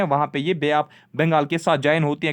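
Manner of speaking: ordinary delivery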